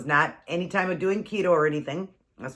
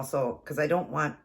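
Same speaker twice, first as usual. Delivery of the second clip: usual speaking voice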